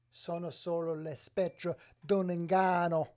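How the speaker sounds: put-on loud, theatrical Italian accent. Streamer showing viewers he can do Italian, loud Italian accent. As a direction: declaim it like a proud Italian. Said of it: "I can make that accent".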